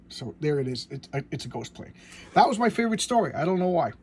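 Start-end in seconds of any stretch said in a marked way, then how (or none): 2.3-3.9 bursts out higher and louder, fast; excited gush. Real, not acted. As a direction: gush about a favourite story. Fan gushing about story.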